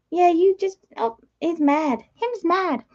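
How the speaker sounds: high-pitched pet-talk voice. Real, not acted. Owner teases cross pet bird, high baby talk.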